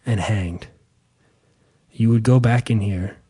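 deep hushed documentary voice; somber tour narrator. Retold or imitated. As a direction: read it like a grave museum guide. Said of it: "it's like".

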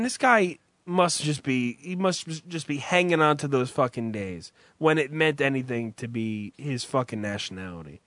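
normal talking voice

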